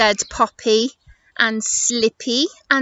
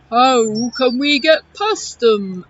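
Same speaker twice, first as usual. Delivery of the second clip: loud, rounded elephant voice; Eddie the elephant; 5 dB louder, slower than usual. Acted. Elephant asking about the pile of rocks, loud creature voice. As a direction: boom it like a storybook elephant. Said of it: "Shall I do it again?".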